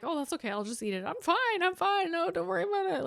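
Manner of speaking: high, bright, overly cheerful reassurance; people-pleasing fake fine. Diner reassuring waiter about wrong meal, high forced cheer. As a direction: chirp it out high so nobody feels bad. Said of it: "I'm like".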